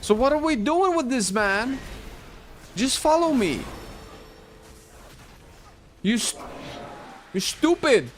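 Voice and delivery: high, loud annoyed shouting; exasperated yelling. Real, not acted. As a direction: scold the dumb AI loudly. Yelling at AI companion.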